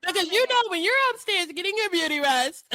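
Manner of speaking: high-pitched mimicking voice; put-on high voice, teasing impression; imitating Kelly. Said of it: "the way that Kelly talks".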